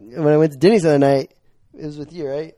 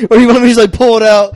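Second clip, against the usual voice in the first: laughing through a quote; 7 semitones higher, 8 dB louder, faster than usual. Real, not acted. Laughing while quoting.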